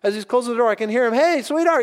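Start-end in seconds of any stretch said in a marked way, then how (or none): 1.1-1.8 high, eager call through the house; calling out to wife. Retold or imitated. Husband closing the door calls his wife, excited high call. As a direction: imitate a husband hollering for his sweetheart. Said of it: "he's telling his wife".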